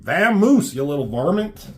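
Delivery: high, loud hammed-up cowboy yell; mock cowboy chasing off a varmint; cowboy shooing. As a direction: shoo the critter off like an old prospector. Shooing the cat in voice.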